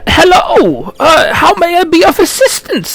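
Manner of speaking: put-on high-pitched, stammering old gnome greeting. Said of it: "he looks up and goes".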